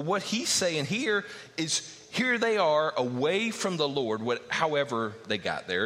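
ordinary delivery

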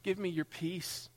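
high, pleading prayer; calling out pleadingly. Acted. Listener calling out to the Lord for peace, urgent plea. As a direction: beg God, building in urgency. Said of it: "calling out, and you're calling out".

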